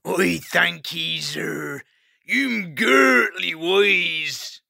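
very slow west country mole; grateful rustic burr, drawn out; west country accent. Acted. Mole thanks hedgehog.

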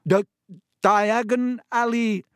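high, loud choking stutter; coughed out. A narrator's reading. Boy with a mouthful of hot ash, coughing. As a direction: choke the words out slowly through coughs. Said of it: "he coughed".

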